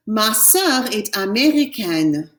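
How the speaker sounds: "Ma sœur est américaine" slowly, the final N of américaine sounded; slow model with the N pronounced; teacher modelling the feminine form; slow French model. Shown as a demonstration. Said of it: "My sister's American".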